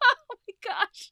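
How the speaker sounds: overwhelmed, fighting back tears; real emotional outburst; close to tears. Real, not acted. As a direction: voice breaking into a cry, high and loud. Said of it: "I'm gonna try not to cry".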